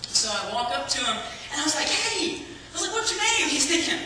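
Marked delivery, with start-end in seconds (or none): 1.9-2.4 much higher, bright eager hello; overeager greeting. Retold or imitated. Greeting a homeless man too eagerly on the street. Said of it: "approaching me with a little too much enthusiasm". 2.9-3.5 much higher, keen and gushing; overeager question. Retold or imitated. Asking the stranger his name far too keenly. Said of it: "approaching me with a little too much enthusiasm".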